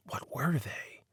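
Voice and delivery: hushed unvoiced musing; whispered wonder. A narrator's reading. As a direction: breathe the question to yourself. Wondering to himself.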